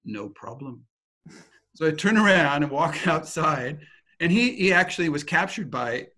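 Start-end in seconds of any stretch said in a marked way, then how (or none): 0.0-0.8 low, hushed, unbothered gremlin; acting a coolly confident sidekick; gremlin's cool reply. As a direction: drop down low and quiet, totally unfazed. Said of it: "Gremlin goes".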